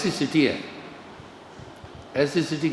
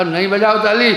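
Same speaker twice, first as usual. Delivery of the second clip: higher, louder exasperated order; retold insistent shout; 5 semitones higher, 6 dB louder than usual. Speaker to an audience still clapping, insistent raised voice. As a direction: call out insistently over the applause.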